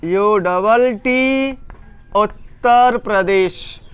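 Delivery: drawn-out dictation of letters and syllables; slow spelling out. Shown as a demonstration. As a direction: spell it slowly, then split the name. Spells the name while writing.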